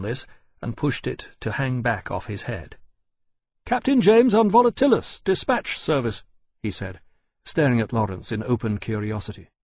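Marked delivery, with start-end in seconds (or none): 3.6-6.2 loud, high-pitched hail; calling out. A narrator's reading. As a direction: announce yourself across open air, high and loud. Rider hails and introduces himself.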